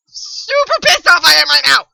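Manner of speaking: loud burst of anger; pissed-off yell. Real, not acted. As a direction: stretch out "super", then yell it. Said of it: "Sorry for yelling".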